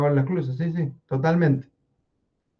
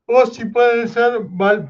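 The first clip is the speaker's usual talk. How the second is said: high, slow, talking through a yawn; words stretched by a real yawn; 8 semitones higher, 3 dB louder, slower than usual. Real, not acted. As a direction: try to speak while a yawn takes over. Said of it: "perdón que estoy bostezando".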